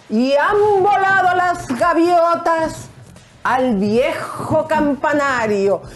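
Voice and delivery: high, slow, theatrical recital; grand poetry declamation. Pretend. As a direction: declaim it like a hammed-up stage poet. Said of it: "voy a bailar y voy a declamar".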